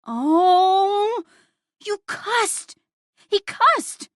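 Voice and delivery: put-on very high, loud child squealing; a kid thrilled to catch a grown-up swearing; delighted tattling. A narrator's reading. Boy bouncing and pointing at the swearer, squealing.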